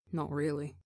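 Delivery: soft, low, beaten-down answer; quiet and defeated. A narrator's reading. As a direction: barely get the words out. Tubbo's low, quiet reply.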